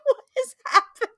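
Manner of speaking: high, laughing disbelief; baffled. Retold or imitated. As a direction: squeal it through laughter. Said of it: "sitting here like".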